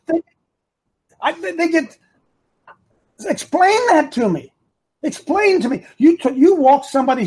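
high-pitched, stammering disbelief; baffled and worked up; exasperated. Real, not acted. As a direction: demand an answer, voice pitched right up. Baffled, demands an explanation.

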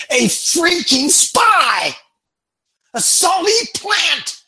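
high, loud scornful rant; angry rant. Real, not acted. A coach rants at his audience, high and loud. Ranting at Hillary's farewell crowd.

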